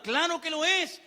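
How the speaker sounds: ordinary talk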